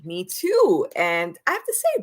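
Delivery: ordinary talk, nothing marked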